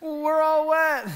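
high mock wail, last words stretched out; imitated crying out for help. Girls calling Chaz to come help, mock crying out. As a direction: call out high, hammed-up and pleading. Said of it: "they were crying out".

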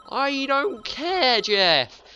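high, loud, drawn-out yell. Real, not acted. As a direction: shout it at the nagging adviser. Yelling at the game's adviser.

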